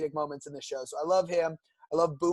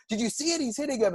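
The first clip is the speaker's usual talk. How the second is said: excited agent; 6 semitones higher, faster than usual. Retold or imitated. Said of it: "he goes".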